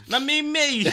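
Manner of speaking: laughing through the words. Real, not acted. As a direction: crack up laughing. Host laughs at own story.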